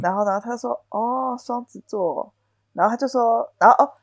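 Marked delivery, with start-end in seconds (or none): 0.9-2.3 很轻很慢的意味深长; 轻声拉长，若有所思; 模仿老板沉吟. Retold or imitated. 模仿老板回应. (老板听到星座后轻声慢慢重复)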